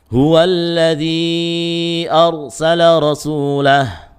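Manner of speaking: slow melodic tajwid chant; Quran recitation. Shown as a demonstration. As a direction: chant the verse slowly with heavy lam.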